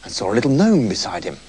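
quiet, low midnight narration; hushed storytelling. A narrator's reading. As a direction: drop to a hushed bedtime hush.

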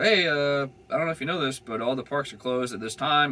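the cop politely breaking the news; cop explaining. Retold or imitated.

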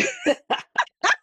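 bright burst of real laughter; surprised laugh. Real, not acted. Host laughing at her cat opening the drawer. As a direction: laugh out loud at the cat, high.

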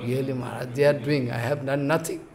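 very soft, low admission; hushed, humbled reply in a low voice; chastened disciple. Retold or imitated. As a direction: give in meekly under a scolding. Chastened disciple answers his guru.

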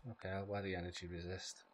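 ordinary delivery

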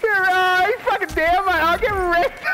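high-pitched, excited jeer; gloating. Real, not acted. Gamer gloats at a downed rival, jeering. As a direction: crow in triumph at the kill.

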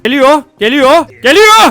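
urgent warning building to a high yell; rising alarm as the pursuer closes in; panicked shouting. Real, not acted. Homeowner chasing the fleeing player.

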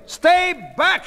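high, loud shouted order; commanding. A narrator's reading. Sheila to the crowd, commanding shout. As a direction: order the crowd back forcefully. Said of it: "Sheila commanded".

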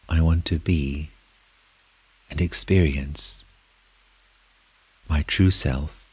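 soft, unhurried meditation voice with long pauses; guided meditation. Acted. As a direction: lead them gently inward, phrase by phrase. Leads a guided meditation in first person.

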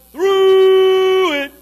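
high, loud, slow; weary groaning call; long drawn-out wail. Acted. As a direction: hold "through" long and loud. Preacher stretching the phrase.

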